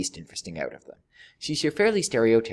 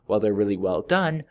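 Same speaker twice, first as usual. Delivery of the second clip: ordinary talk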